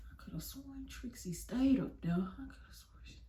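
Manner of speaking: whispered mock muttering; imitated whispered self-talk; talking to herself. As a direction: mutter it under your breath as if puzzled. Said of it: "talks to herself".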